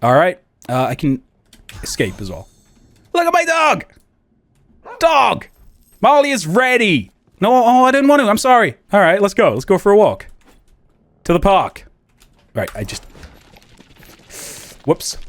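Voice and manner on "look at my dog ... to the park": excited dog owner. Real, not acted. Excited about the game dog.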